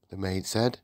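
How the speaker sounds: plain reading voice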